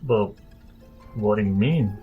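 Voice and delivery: normal talking voice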